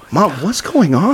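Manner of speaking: high-pitched retold plea to mom; overwhelmed, disbelieving question; bewildered. Retells overwhelmed call to mother.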